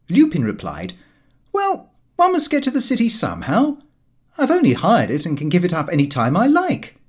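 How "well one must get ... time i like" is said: high, breezy excuse; airy dismissal. Acted. Lupin waving off his father's complaint, high and breezy. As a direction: brush off the worry lightly.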